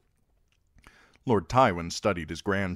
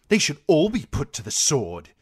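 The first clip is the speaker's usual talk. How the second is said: put-on high, loud, petulant boy king; sudden declaration; 9 semitones higher, 7 dB louder than usual. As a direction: blurt it out like a spoilt young king. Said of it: "Joffrey declared suddenly".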